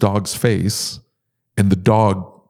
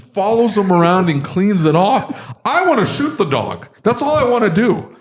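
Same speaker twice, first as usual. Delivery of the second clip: fired up; 9 semitones higher than usual. Real, not acted. Husband venting about the dog and his poor wife, fired up. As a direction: plead your case in a worked-up high voice. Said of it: "Got him fired up here".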